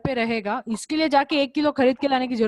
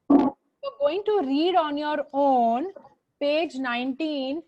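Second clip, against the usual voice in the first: slow dictation; much slower than usual. Shown as a demonstration. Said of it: "teacher dictating homework slowly".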